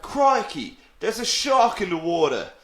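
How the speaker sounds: exaggerated high Australian accent; startled, put-on Aussie exclamation; Steve Irwin. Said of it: "I sounded like Steve Irwin there".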